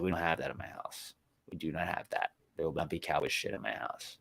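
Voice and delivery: low, soft, firm decree; understated retold stern warning; stern house rule. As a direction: lay down the law quietly and low.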